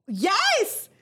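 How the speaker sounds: high, squealing and giddy; excited squeal. Real, not acted. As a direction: squeal it out in delight. Laughing shock at big mouth.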